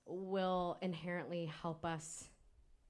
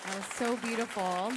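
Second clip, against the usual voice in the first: gushing praise; 3 semitones higher, 8 dB louder than usual. Real, not acted. Host praising the previous speaker.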